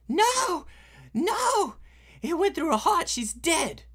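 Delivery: high, loud horrified cry; shocked witness. Acted. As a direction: scream it high in horror.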